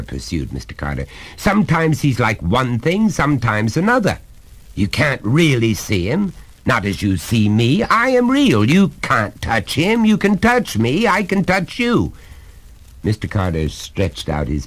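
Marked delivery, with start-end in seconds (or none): 1.4-12.1 much higher, mounting emphasis; exasperated, pitched-up father; insistent lecturing father. Acted. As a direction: drive the point home to a child.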